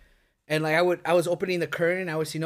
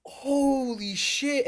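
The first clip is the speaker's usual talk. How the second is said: high, slow, stretched-out amazement; stunned awe; 7 semitones higher, much slower than usual. Retold or imitated. Storyteller acts out the siblings reading the board, stunned drawl. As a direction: draw the first word out in disbelief. Acted stunned reaction to prediction.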